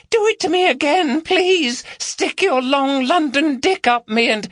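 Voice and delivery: high falsetto mock woman pleading; mocking female voice. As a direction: overdo a swooning woman's voice. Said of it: "mimicked a female voice".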